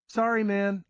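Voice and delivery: put-on high girl voice, casual; a young girl apologizing; girl's voice. A narrator's reading. Girl answering narrator at pool table, high casual voice.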